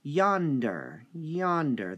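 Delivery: yonder with YON hit hard; teacher stressing the first syllable of yonder. Shown as a demonstration. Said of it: "The yon part is stressed".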